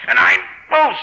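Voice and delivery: high straining effort cry; straining menace. Acted. Harvey forcing Arkwright toward the edge, straining. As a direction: grunt it out while shoving. Killer pushing victim over cliff.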